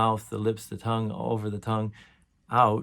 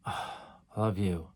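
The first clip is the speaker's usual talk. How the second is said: sighed I love you; 3 semitones lower, 5 dB quieter than usual. Shown as a demonstration. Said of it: "in twenty different ways".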